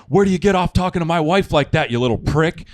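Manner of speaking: loud, high mock tirade; angry husband. The husband confronts the teen prankster, mock angry shout. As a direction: tell him off like the furious husband. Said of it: "her husband comes out and just goes".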